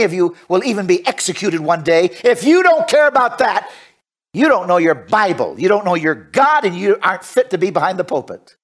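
opens high and forceful, then settles; indignant preaching. Real, not acted. Preacher rebukes those who don't care, indignant preaching. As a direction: rebuke the listeners from the pulpit.

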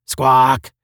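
sudden loud squawk; headphone squawk. A narrator's reading. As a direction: blurt it like interrupting radio noise. Headphone noise answers him.

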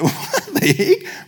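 drawn-out, high incredulous squawk with a laugh; slow, laughing bafflement; comic disbelief. Retold or imitated. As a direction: stretch it out in mock shock. Own startled reaction to reading.

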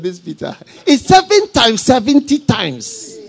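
seven times seventy times, slow, loud and drawn out; the Lord's emphatic, slow answer; stress on seventy times. Acted. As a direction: spell out seven times seventy times, slowly and loudly. The Lord answering Peter.

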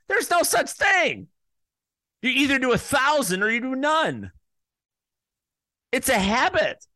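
high-pitched, emphatic declaration; heated insistence. Real, not acted. Host rants about people who write Yelp reviews, heated insistence.

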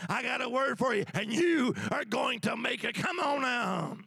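loud, high preacher's cry; full-force preaching. Acted. Preacher rousing the congregation, loud and high.

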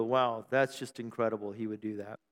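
low, very soft, underwhelmed wow; mock amazement in a low murmur; deadpan mock awe. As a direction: let it sink quietly, barely impressed. Mimics unimpressed listeners' reaction.

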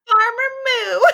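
put-on old farmer. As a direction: introduce yourself as a hammy farmer. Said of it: "when I talk like a farmer".

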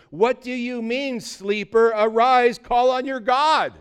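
loud, high demand to a sleeper; acted captain calling out urgently; captain's rousing shout. Captain rebukes sleeping Jonah.

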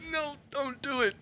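very high, small mock plea; tiny pleading voice. His conscience begging him not to fight, tiny high voice. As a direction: squeak it out high and thin, imitating a plea.

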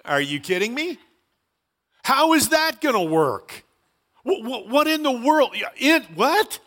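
stammering, hammed-up disbelief, pitch climbing; incredulous disciples. Disciples hearing they must reach the earth's ends, comically incredulous. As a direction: act baffled, spluttering for words. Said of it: "And they go".

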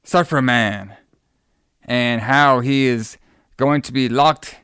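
normal talking voice

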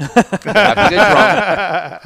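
laughing it out slow and loud; can't hold back the laugh, drawing the words out. Real, not acted. Laughing loudly while telling friends about Japanese drinking.

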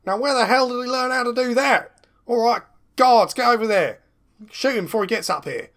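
high, loud commanding shout; villain ordering guards. Acted.

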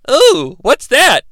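slow, high-pitched mock curiosity; Aang. Aang answering the Keymaker's invitation, mock innocent curiosity. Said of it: "And Aang's like".

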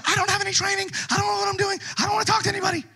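mock panicked excuses; panicked realization. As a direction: blurt out sudden panic. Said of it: "you realize".

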